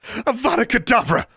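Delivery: very high cried incantation; shrieked-out spell; spell cry. A narrator's reading. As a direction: hurl the deadly curse. Casting a killing spell in duel.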